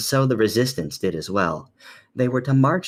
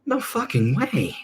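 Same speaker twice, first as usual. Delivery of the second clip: shocked disbelief; 11 semitones higher than usual. Acted. Character shocked, far and higher.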